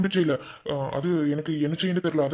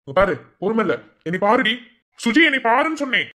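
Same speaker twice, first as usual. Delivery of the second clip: louder and higher, growing impatient; stern threatening; 6 semitones higher, 6 dB louder than usual. Acted. Man losing patience, loudly ordering Suji to look at him. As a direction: lose patience and demand she look. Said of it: "ஏன் மிரட்டுறியா?".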